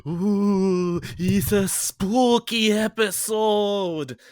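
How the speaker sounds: high, drawn-out ghostly ooh, swelling loud; hammed-up spooky wail. Host opens the Halloween episode, spooky ghost voice.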